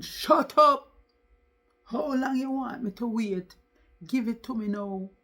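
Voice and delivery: loud, high angry outburst; shouted, impatient demand. A narrator's reading. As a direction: raise your voice sharply, then ease slightly. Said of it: "Roland's voice got louder".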